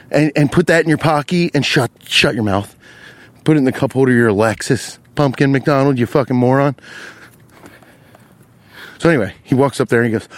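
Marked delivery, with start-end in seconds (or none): none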